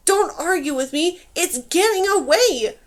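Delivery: high, loud exasperated shouting; frantic command carrying through walls; yelling. A narrator's reading. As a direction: shout down dim underlings, building. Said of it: "voice was clear even through".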